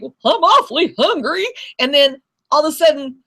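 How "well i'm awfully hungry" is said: hungry lion. Retold or imitated. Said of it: "the lion's like".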